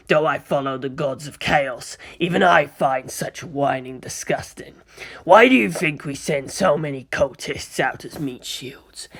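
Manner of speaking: sinister villain voice, full of disgust; contemptuous, drawn-out villain's complaint; disdainful Chaos warrior. Acted. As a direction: sneer like a dark sorcerer taking offence. Thousand Son figurine complains.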